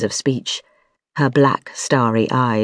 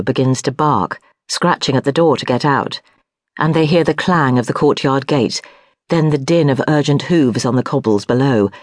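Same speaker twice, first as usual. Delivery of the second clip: plain reading voice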